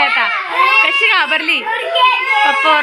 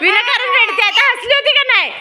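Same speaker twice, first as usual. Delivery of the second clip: chiding; 7 semitones higher than usual. Real, not acted. Parent chides crying child.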